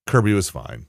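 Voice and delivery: ordinary talk, nothing marked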